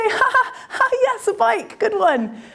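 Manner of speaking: high, fake polite laughter; forced laugh. [name] laughing awkwardly at Gao's bike news. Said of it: "At which point I sort of say".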